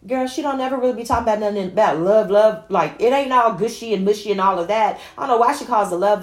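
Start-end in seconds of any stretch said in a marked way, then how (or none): 0.1-5.0 fast, louder sassy voice; sassy listener. Retold or imitated. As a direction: rattle it off like a doubting friend. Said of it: "half of y'all are like".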